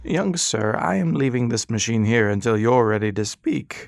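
patient, polite, in no hurry; old doctor. Acted. Old doctor addressing Ras.

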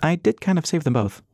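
bright, high and cheeky; playful perky girl voice; perky young woman. A narrator's reading. Dixie continues.

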